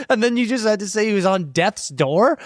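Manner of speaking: ordinary talk, nothing marked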